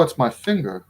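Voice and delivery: normal talking voice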